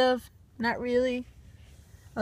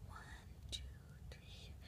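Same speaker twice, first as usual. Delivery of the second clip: hushed counting, trailing off; whispered count; 31 dB quieter than usual. Pretend.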